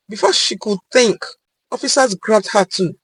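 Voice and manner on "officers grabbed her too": ordinary delivery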